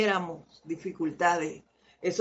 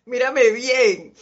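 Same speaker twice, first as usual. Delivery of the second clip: slow and louder through a laugh; laughing slowly through the repeat; 3 semitones higher, 7 dB louder, slower than usual. Retold or imitated. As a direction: let the laugh spill into the line. Repeats the line while laughing.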